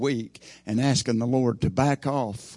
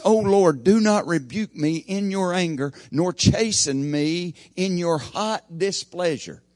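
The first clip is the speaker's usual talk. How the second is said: loud, high, ringing psalm reading; preacher crying out David's prayer to God, loud and high; preaching scripture; 6 semitones higher, 4 dB louder than usual. Acted. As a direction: proclaim the plea from the pulpit, slowing at the end. Reads David's psalm with force.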